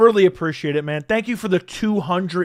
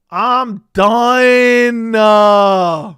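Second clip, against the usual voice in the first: overwhelmed shout; 4 semitones higher, 8 dB louder, much slower than usual. Real, not acted. Overwhelmed by big donation.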